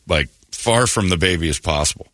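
ordinary talk, nothing marked

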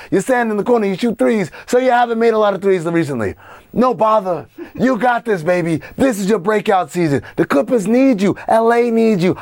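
fast-talking Hollywood agent patter; Hollywood guy. Pretend. Host hyping Robbie as the Hollywood guy, put-on showbiz voice. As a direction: schmooze and hype like a Hollywood player. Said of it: "we also got the Hollywood guy".

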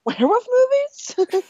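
"movies?" squeaked up high as a question; high, rising question on "movies?"; upward inflection. Shown as a demonstration. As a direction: pitch "Werewolf movies?" far up, asking. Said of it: "With the upward inflection?".

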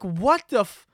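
high, cut-off disbelief; incredulous reaction. Retold or imitated. Customer hearing beer price, high incredulous outburst. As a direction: react in mock shock at the price.

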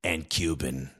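very low, soft New York confiding; New York accent. Retold or imitated. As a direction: lean in with a deep, hushed New York voice. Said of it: "She leans over and she goes".

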